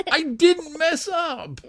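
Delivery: high, drawn-out protest through real laughter; can't keep the laughter out of it; hysterical laughing. Real, not acted. Host insisting he didn't slip, laughing hysterically. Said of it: "five-minute hysterical laughing break".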